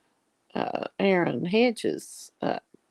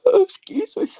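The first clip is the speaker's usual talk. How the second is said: yawning; 6 dB louder than usual. Real, not acted. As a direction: yawn through the words. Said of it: "Oh, that was rude".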